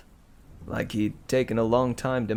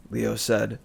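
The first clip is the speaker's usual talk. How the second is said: usual speaking voice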